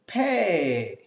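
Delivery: "pay" as the French P, crisp pay; French letter name. Shown as a demonstration. Teacher demonstrates French letter P to students. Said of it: "P becomes".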